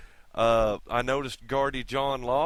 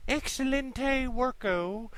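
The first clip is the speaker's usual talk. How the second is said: mock Spanish; 10 semitones higher, slower than usual. Mock praise for Gilroy's work.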